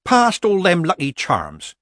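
mock meek. Granny needles Missus Earwig about Lucky Charms, mock meek. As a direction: needle her slowly while sounding innocent. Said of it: "said Granny meekly".

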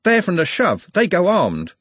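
high, loud, urgent warning; blurted out. A narrator's reading. Trev warning that the rival players carry weapons, blurted out. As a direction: blurt out the danger. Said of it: "Trev blurted out".

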